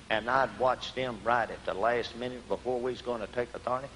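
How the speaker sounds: soft, low, confiding voice; quiet earnest testimony. Real, not acted. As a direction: drop down and share it quietly from the heart. Earnest personal testimony to congregation.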